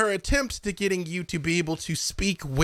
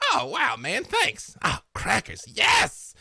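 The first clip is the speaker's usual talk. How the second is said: higher, squeaky eager bird; a touch of excited parrot; 7 semitones higher, slower than usual. Acted. Parrot Trievor thanking Ariza, squeaky excited. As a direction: chirp it out eagerly.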